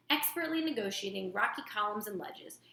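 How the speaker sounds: usual speaking voice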